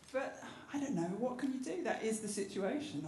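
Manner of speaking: ordinary talk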